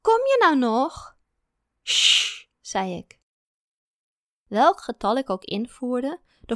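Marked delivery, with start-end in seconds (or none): 0.0-1.2 high, slow whining question; slow nagging whine; whiny plea. A narrator's reading. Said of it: "klonk er jengelend". 1.8-2.5 shush. A narrator's reading. Shushing partner from desk.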